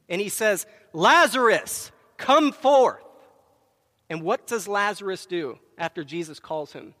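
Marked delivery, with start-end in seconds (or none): 0.9-3.0 loud, high ringing command; commanding call. Retold or imitated. Jesus calling dead Lazarus out of the tomb, loud command. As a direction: call him out of the grave with full voice.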